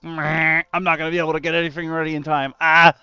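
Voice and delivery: fast panicked babble, far voice; panicky anxious rush. Retold or imitated. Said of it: "like, anxiety".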